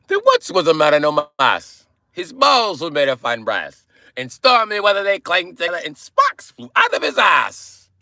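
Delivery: high, shouted Irish rhyme; hammed-up Irish imp, rising to a shout; Leprechaun. Shown as a demonstration. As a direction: belt out a limerick in a mock Irish accent. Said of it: "I got that from Leprechaun".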